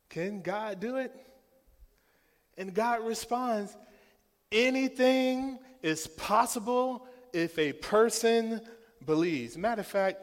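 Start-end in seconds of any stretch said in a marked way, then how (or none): 4.5-9.6 loud, high preaching cry; booming preaching. Acted. Pastor proclaiming to the church, loud and high. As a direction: proclaim it over the congregation. Preacher quoting God at full force.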